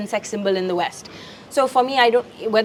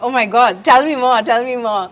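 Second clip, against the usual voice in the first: excited; 4 semitones higher, 5 dB louder than usual. Retold or imitated. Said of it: "so I was like".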